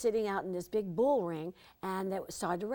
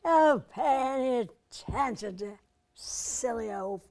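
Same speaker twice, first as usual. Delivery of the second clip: slow, loud, higher grand diva voice; Bette Davis advising; 5 semitones higher, 7 dB louder, slower than usual. Retold or imitated. Bette advising young Debbie, slow and grand. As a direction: drawl it out like a grand old star. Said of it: "she'd say".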